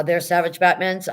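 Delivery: ordinary talk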